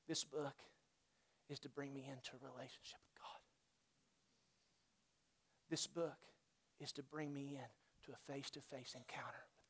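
hushed, breathy whisper to the church. Acted. Pastor whispering to the congregation what the book is for. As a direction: drop to a whisper to draw them in. Hushed intimate sermon point.

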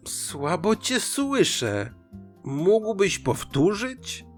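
slow, high, drawn-out fox; sly, stretched-out request; feigning deafness. Acted. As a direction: ask slowly, as if hard of hearing.

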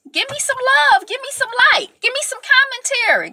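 playful high-pitched plea to viewers; high pleading call. Real, not acted. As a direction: give me some love, sung way up high. Begging viewers for support.

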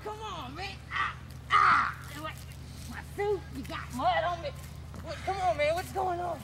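high, soft frightened babbling; panicky whining. Acted. Carried off by Mister Sober, whining in fear. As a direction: whimper and plead, scared out of your wits.

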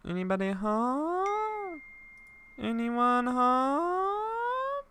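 high, very slow call into the house. Pretend. Cop calling into an empty house, drawn out. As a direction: stretch it out like calling down a hall.

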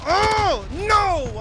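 loud, high wail of dismay; high, drawn-out yell; anguished cry. Real, not acted. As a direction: cry out as the chance slips away. Botches a kill streak moment.

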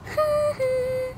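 quiet breathy laugh. Real, not acted. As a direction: laugh softly. Laugh after story joke.

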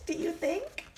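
usual speaking voice

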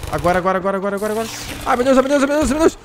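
very high, loud frantic cries; losing it as monsters swarm; panicked screaming. Real, not acted. Gamer overwhelmed by a horde of monsters, panicked screaming.

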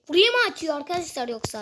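ordinary talk, nothing marked

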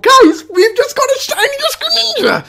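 excitement climbing into a high squeal; ecstatic squeal. Real, not acted. Streamer tells viewers about the shiny, ecstatic squeal. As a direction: let the voice shoot up and break into glee.

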